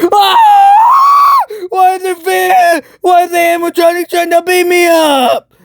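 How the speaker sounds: shrieking mock panic at an attacking animatronic; panicked scream. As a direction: scream it like a horror victim. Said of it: "Markiplier's like".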